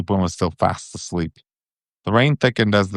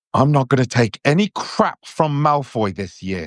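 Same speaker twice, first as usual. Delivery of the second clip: higher, heated vow; mildly furious, fists clenched; Ron, angry at Malfoy's insult; 5 semitones higher than usual. A narrator's reading. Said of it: "he said angrily".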